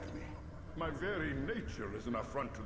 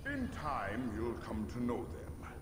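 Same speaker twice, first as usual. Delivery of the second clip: usual speaking voice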